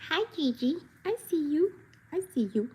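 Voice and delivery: high sweet greeting to the dog; high pet talk. Real, not acted. Greeting her dog Gigi, high pet talk. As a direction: coo at the dog.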